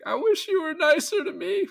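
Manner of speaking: high-pitched Sandler-style fake whine; hammed-up tearful plea in a high voice; mock crying. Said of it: "like, crying in Billy Madison".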